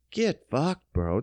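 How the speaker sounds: ordinary talk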